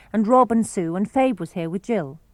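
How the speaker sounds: plain reading voice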